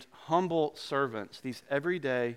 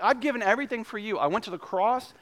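pleading Jesus; 7 semitones higher than usual. Retold or imitated. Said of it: "Jesus is like".

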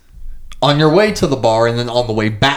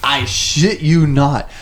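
normal talking voice

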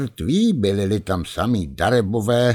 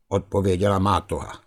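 plain reading voice

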